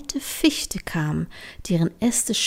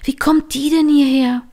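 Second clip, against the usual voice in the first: astonished; 6 semitones higher, 7 dB louder than usual. Acted. Astonished at finding the coin.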